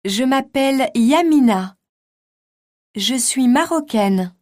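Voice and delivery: usual speaking voice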